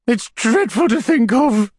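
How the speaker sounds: high widow voice, slow and shuddering. A narrator's reading. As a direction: shudder through it like a delicate widow.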